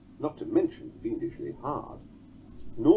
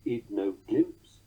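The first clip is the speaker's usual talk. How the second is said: slow "id no glimpse" with stress on GLIMPSE; detached fragment "id no glimpse", rising to the beat; "id no glimpse" as a slow titty-TUM; single foot; slower than usual. Shown as a demonstration. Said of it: "two and a third words".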